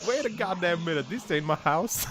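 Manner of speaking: hammed-up puzzled protest; imitating confused driver. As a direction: act out the baffled driver. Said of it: "He's like".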